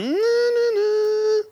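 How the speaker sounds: put-on very high-pitched, drawn-out kid whine; tempted little kid. Little kid left alone with the candy, high mock whine. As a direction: squeal like a toddler fighting temptation. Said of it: "you see that little kid going".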